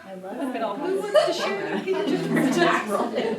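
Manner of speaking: laughing through the words; giggling along to the clip; real laughter breaking into the words. Real, not acted. Laughing over the classroom clip.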